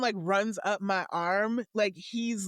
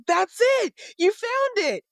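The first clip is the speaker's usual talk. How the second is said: much higher, louder triumphant shout; excited cheer; 13 semitones higher, 7 dB louder than usual. Acted. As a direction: cheer the squirrel for finding it. Garen cheers the digging squirrel.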